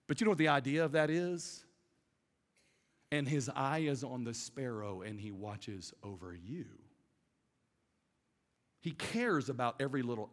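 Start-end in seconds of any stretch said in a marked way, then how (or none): 3.1-6.6 tender scripture quote. Acted.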